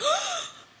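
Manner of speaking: high gasping shriek of alarm; teacher's gasp at the window; mock gasp. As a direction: imitate a startled gasp. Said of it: "and then was like".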